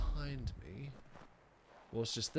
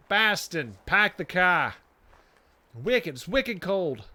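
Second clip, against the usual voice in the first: loud, higher-pitched attempt at Boston accent; bad Boston accent; 5 semitones higher, 9 dB louder, slower than usual. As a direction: punch out a badly faked Boston accent. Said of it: "I can't do a Boston accent".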